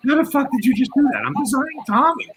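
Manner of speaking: high, stunned retold exclamation; retold amazement. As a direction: play the friend floored by the news. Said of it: "He calls me back and goes".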